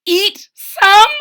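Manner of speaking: high loud shocked gasp. A narrator's reading. Bartholomew horrified by the captain, loud shocked gasp. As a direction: gasp it in disbelief. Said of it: "gasped Bartholomew".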